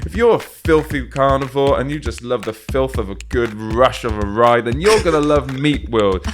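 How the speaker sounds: loud, hyped-up commercial pitch; cheesy TV advert. Pretend. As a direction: sell it like an over-the-top ad.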